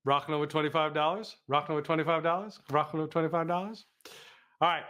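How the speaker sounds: ordinary delivery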